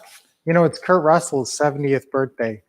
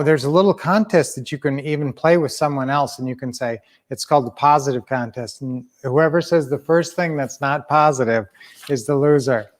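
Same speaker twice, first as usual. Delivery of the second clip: ordinary talk, nothing marked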